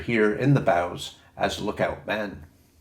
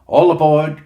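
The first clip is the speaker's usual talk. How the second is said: louder, higher all aboard call; 7 semitones higher, 11 dB louder than usual. A narrator's reading. Mate Susan calls the crew into the boat, louder. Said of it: "cried Mate Susan".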